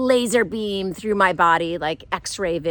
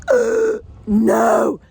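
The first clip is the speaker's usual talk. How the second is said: upset disgust; 6 dB louder than usual. Retold or imitated. Woman rejects the kiss line, loud disgusted refusal. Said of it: "I was so upset".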